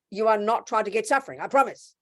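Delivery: ordinary talk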